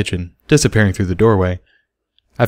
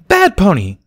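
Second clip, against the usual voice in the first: high, loud mock scold; playful child scolding; 11 semitones higher, 5 dB louder, slower than usual. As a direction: wag a finger at him. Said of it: "Peanut scolded".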